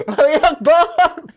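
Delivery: high, loud, stretched-out holler through laughter; mock teenage heckling yell; drawn-out yell. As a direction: yell it slowly, cracking up. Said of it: "and then we'd yell".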